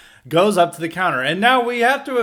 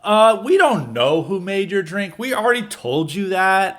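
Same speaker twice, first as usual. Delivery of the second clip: mock condescending staffer, a bit higher; 5 semitones higher, 5 dB louder than usual. Retail staffer to angry dad, condescending. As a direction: talk down to him like a bored clerk. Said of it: "talking condescendingly to him".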